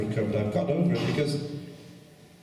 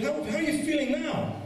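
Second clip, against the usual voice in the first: high mocking woman's voice. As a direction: pitch it way up like the woman asking.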